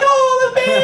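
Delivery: squeaky high falsetto cooing; baby-talk voice. Acted. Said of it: "that's the regular baby voice".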